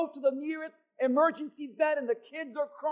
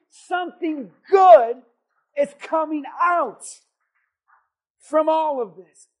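preaching at full force; 4 semitones higher, 10 dB louder than usual. Real, not acted. Pastor proclaims to congregation, full-force preaching. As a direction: preach it to the rafters.

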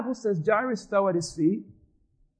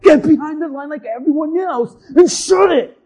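loud, higher, fed-up snap at a line cutter; angry outburst; 3 semitones higher, 5 dB louder than usual. Acted. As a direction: lash out, loud and exasperated. Preacher acts out lashing out in line.

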